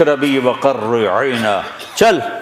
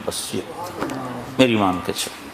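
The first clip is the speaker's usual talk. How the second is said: soft low repeat; 5 semitones lower, 9 dB quieter than usual. A narrator's reading. Quieter repeat.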